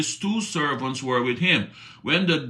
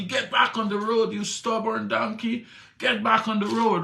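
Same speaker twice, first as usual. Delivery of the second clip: higher, scolding yell at a donkey; mock scolding a stubborn donkey; angry rider; 8 semitones higher than usual. Balaam yelling at his balking donkey, mock-angry.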